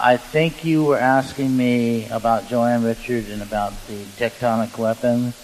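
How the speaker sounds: usual speaking voice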